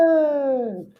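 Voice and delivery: a shrill little cheer of relief; high squeal of glee. Real, not acted. Host cheers on finding the lost prop, high and gleeful. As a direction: squeal yay as the lost thing turns up.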